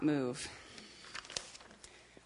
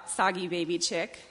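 ordinary delivery

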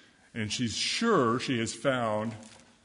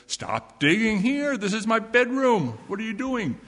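cross mole; 9 semitones higher, 6 dB louder than usual. Acted. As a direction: tell her off like a grumpy sleeper. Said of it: "And the mole says".

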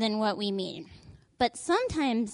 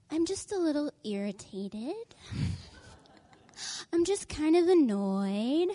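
mock Kansas nice; 5 semitones higher than usual. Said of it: "Kansas nice".

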